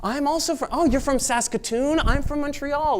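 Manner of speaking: thrilled Montrealer abroad, high and loud; gushing excitement. Retold or imitated. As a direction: squeal like meeting an old friend. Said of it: "I'm like".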